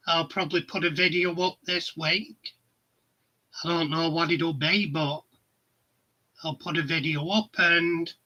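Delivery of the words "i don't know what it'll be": ordinary delivery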